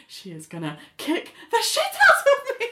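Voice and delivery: high-pitched panicky squeal; mock panic. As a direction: squeal it like you're dreading a beating. Acting out Ali's dread of being beaten.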